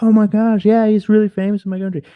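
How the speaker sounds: delighted shopkeeper. Retold or imitated. Clerk thrilled a customer knows his star, excited. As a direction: beam with pride at a famous name. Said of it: "he'd be like".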